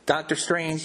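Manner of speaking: ordinary delivery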